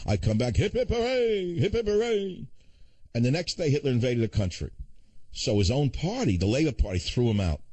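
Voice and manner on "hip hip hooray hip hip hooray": high, hammed-up cheering crowd; mock crowd cheer. Talk host mimics a crowd cheering the returning prime minister, high mock cheer. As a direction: cheer him home like a jubilant crowd.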